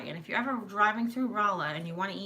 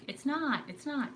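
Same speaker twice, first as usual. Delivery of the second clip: higher-pitched mock bickering; pretend arguing; 7 semitones higher, slower than usual. Imitating relatives arguing over Missouri, mock bickering. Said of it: "pretend arguing".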